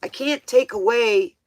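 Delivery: ordinary talk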